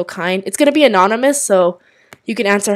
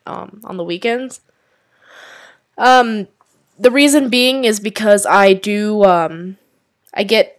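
ordinary talk

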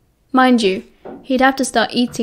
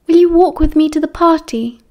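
Lily's voice; 5 semitones higher, 4 dB louder than usual. A narrator's reading. Lily voiced in story.

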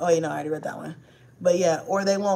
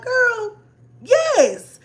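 emphatic yes; 13 semitones higher, 6 dB louder than usual. Retold or imitated. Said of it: "I'd be like".

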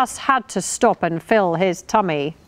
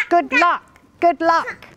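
high, squawky duck character; high-pitched quacky well-wishing; 9 semitones higher than usual. A narrator's reading. Duck character wishes luck.

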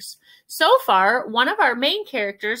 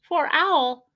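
ordinary delivery

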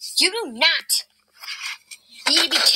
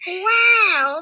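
ordinary delivery

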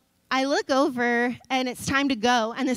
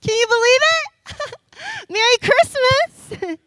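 high, loud and thrilled, slowing on the greeting; bursting with glee over a present; delighted reveal; 10 semitones higher, 7 dB louder than usual. Real, not acted. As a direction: show off the gift like it's Christmas morning. Unveils present to kids, delighted.

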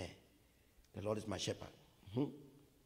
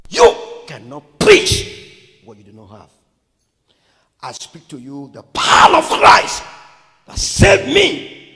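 loud, forceful bursts from the pulpit; fiery testimony; full-force preaching; 20 dB louder, slower than usual. Real, not acted. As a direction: punch out key phrases at a shout.

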